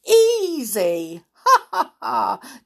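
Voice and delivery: loud, high cackle; gloating laugh. Acted. Giant Twisty laughing at the trapped group. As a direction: laugh in triumph. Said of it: "heard Twisty laughing loudly".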